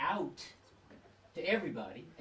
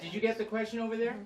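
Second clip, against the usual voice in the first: louder, calling across the room; to someone hard of hearing; 8 semitones higher, 7 dB louder, faster than usual. Real, not acted. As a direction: raise your voice for the back row. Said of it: "Can you speak up".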